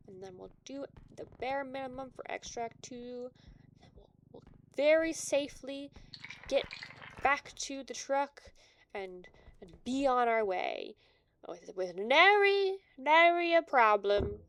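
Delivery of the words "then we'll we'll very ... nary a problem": mock heroic boast. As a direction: announce the safe escape like a hero. Mock confident plan, loud.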